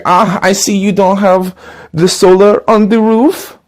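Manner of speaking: put-on salesman with a thick German accent, slow and polite; a German door-to-door salesman greeting a customer. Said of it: "like actually having a Nazi at your doorstep".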